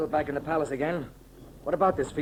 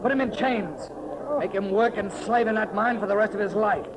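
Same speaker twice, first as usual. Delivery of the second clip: raised, commanding voice; imperial command; 6 semitones higher than usual. Acted. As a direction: pronounce sentence as emperor. Victorious emperor gives orders.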